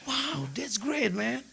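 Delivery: impressed foreigner in English, lightly done; mock Western tourist. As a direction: playfully imitate an amazed Westerner. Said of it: "kalau bule kagum".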